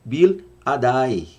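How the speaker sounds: "Bil Adai" drawn out slowly; the Hebrew "Bil Adai" spoken slowly and carefully. Shown as a demonstration. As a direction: say "Bil Adai" syllable by syllable, unhurried. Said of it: "Bil, no hebraico".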